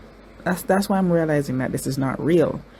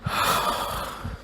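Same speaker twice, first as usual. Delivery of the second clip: long unvoiced sigh to steady herself; a heavy breath out to hold it together; shaky sigh; 6 dB quieter than usual. Real, not acted. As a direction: breathe out the tears. Trying not to cry.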